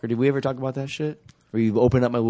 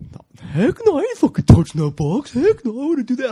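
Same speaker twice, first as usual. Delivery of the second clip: squeaky defensive brother, much higher; high mock denial voice; 14 semitones higher, 4 dB louder than usual. Brother denying he opened the box, in a high mocking voice. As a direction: play the little brother swearing he didn't touch it.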